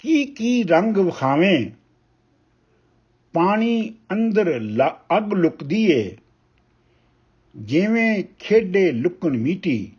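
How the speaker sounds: measured, weighty reading of a Punjabi poem; ਕਵਿਤਾ ਪਾਠ ਦੀ ਠਹਿਰਾਵ ਵਾਲੀ ਅਵਾਜ਼; poem recitation. Acted. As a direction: recite each line slowly, letting pauses breathe. Poet recites poem Lukan Miti.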